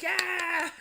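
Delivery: high, loud party cheer; crowd cheer. Retold or imitated. Party guests cheering Tony's trick, loud and high. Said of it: "People are just like".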